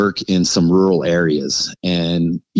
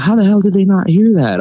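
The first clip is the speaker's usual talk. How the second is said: high, louder disbelief; incredulous wife; 12 semitones higher, 5 dB louder than usual. Retold or imitated. Wife asking how the kids missed it, high disbelief. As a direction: voice the wife's shocked question.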